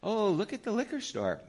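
high, playful distracting voice; talking to a toddler. Grandfather distracting his crying grandson while window shopping, child-directed voice. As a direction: coo it to a little child.

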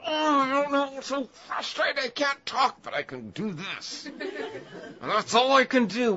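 high, strained, words squeezed through clenched teeth; talking through gritted teeth. Retold or imitated. Pastor acting out a frustrated parent talking through gritted teeth. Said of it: "you grit your teeth".

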